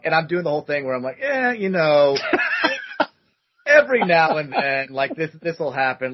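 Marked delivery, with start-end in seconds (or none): 1.2-6.1 casual with a laugh; playing it cool. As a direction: act unbothered though thrilled. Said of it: "trying to play it cool".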